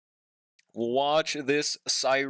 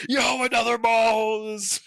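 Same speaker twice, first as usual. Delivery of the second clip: excited shout; 8 semitones higher, 5 dB louder, slower than usual. Pretend. Mock excited shout at pull.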